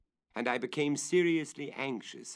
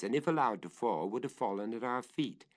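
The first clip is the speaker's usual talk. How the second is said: plain reading voice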